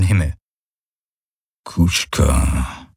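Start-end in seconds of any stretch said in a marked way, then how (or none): 1.6-2.9 deep alien rumble; low grumble. A narrator's reading. Hawks grumbles a warning to Kirai, deep and low. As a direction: growl the word low in the chest. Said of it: "brummte er".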